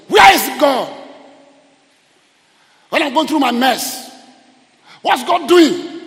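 loud, high-pitched cry of complaint; anguished shout. Acted. Person in a mess demanding where God is, anguished shout. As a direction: cry it out at full voice in distress. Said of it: "Somebody said".

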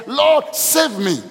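Peter crying out for rescue, slow; a drawn-out desperate plea; cried out. A narrator's reading. Peter sinking, crying out to Jesus, slow plea. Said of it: "he cried out, saying".

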